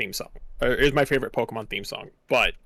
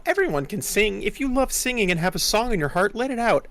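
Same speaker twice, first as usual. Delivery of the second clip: mock inspirational; 8 semitones higher than usual. Mocking her everyone-can-sing line.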